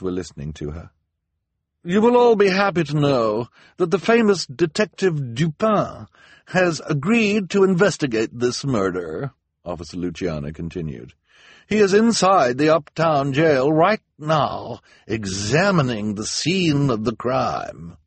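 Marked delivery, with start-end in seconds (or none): 1.9-9.3 grand. A narrator's reading. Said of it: "she said grandly". 11.7-18.0 grand. A narrator's reading. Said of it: "she said grandly".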